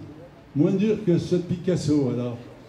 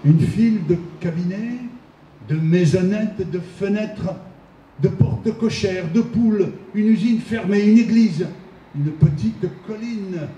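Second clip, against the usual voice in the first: emphatic verse reading, raised pitch and firm volume; poem recitation; 4 semitones higher than usual. Acted. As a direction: recite the list item by item with pauses. Reads Chagall's poem aloud.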